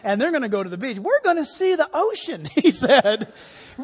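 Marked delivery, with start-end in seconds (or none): 1.0-2.4 put-on higher-pitched eager kid quote; excited little boy. Preacher quoting a boy bound for the beach, excited. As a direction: imitate a thrilled five-year-old.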